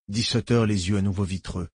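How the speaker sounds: plain reading voice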